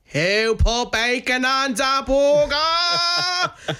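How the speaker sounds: very high, very slow drawn-out accusation; hammed-up manager demanding who did it; stern boss. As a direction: stretch every word like a boss who caught you. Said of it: "And she just goes".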